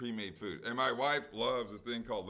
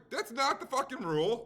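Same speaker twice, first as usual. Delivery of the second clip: high, loud indignant outburst at the rule; retold angry retort; 12 semitones higher, 4 dB louder than usual. Arguing with the deli clerk about her rule, indignant. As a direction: fire it back in disbelief. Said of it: "I didn't say fucking".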